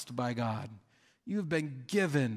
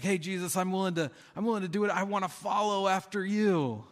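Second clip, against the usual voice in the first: bright, raised, keen plea; eager young ruler; 6 semitones higher than usual. Acted. Rich young ruler eagerly offering to follow Jesus.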